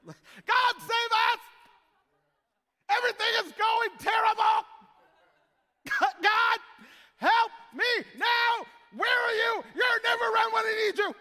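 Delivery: high, loud hammed-up panic; mock the screaming prayer at full volume; frantic prayer shout. Pastor mocking his own panicked prayers, hammed-up yelling. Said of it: "sounds a lot like my prayers".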